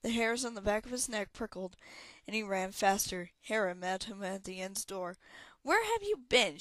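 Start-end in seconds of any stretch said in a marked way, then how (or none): 5.6-6.6 high, loud demand for an answer. A narrator's reading. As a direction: demand it sharply with pitch jumping up high. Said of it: "she demanded".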